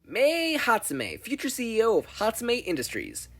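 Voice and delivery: slow, high, drawn-out self-announcement; grand introduction. Acted. Mei introducing herself to Izuku, grand and slow. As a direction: present yourself like a future CEO.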